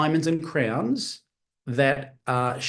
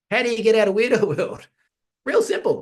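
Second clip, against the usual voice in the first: laughing; 7 semitones higher, 3 dB louder than usual. Real, not acted. Teacher jokes, laughing.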